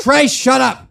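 loud snap at a friend; sharp shout. Real, not acted. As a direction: shut him up fast. Cuts off Trey's blabbing.

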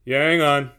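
loud, indifferent call; offhand shout. A narrator's reading. Guard putting off the visitor, offhand shout. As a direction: holler it back through the door.

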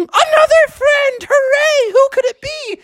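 high, sing-song cheer; gleeful frog excitement; acted delight. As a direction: cheer for a new visitor. Froggy hears another guest arrive.

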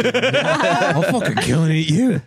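slow, high laughing growl; mock monster threat. Tree creature joking back at the player, slow mock menace. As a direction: threaten like a silly little beast.

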